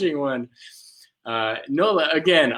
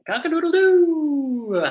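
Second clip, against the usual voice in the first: high, loud cock-a-doodle-doo with a long falling tail; rooster crow; 9 semitones higher, 4 dB louder than usual. Pretend. Music teacher waking the little birds, rooster crow.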